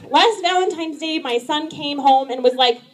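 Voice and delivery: ordinary talk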